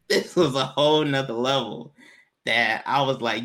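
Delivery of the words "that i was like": usual speaking voice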